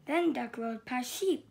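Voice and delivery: ordinary delivery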